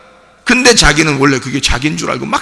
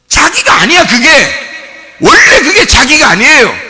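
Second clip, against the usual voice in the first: high, urgent preaching voice; impassioned high-pitched sermon; forceful preaching; 10 semitones higher, 5 dB louder than usual. Real, not acted. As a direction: drive the warning home from the pulpit.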